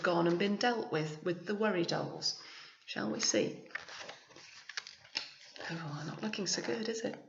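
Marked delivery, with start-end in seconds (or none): none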